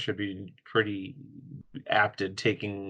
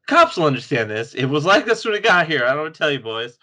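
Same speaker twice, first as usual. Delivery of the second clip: mock character voice; 5 semitones higher, 8 dB louder than usual. Mock cop-facing character quote.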